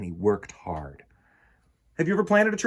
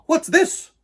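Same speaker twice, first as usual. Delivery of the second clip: surprised question; 9 dB louder than usual. Acted. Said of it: "Papa asked".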